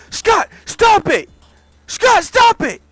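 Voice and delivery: high, loud yell, begging him to stop; frantic yelling. Acted. Dana on the floor yells at Scott to stop, high shout. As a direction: scream for the fight to stop. Dana pleading during the fight.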